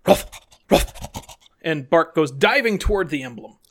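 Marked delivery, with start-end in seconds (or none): 0.1-0.9 two short dog barks; quick imitated "ruff". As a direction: bark like an eager dog. Player imitates the dog barking.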